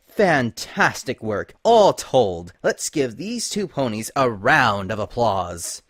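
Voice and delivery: slow sarcastic praise; bitter mocking. Celestia mocks the spies with slow bitter sarcasm. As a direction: drag out the fake applause. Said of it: "Her voice took on a bitter, mocking tone".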